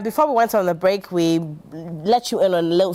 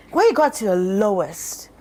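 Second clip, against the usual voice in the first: normal talking voice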